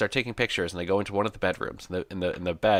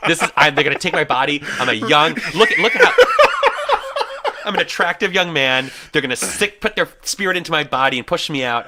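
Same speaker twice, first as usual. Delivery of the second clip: hammed-up fright, much higher and louder; mock panic; 11 semitones higher, 11 dB louder than usual. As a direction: ham up the terror of a horror victim. Panicked horror-movie fantasy.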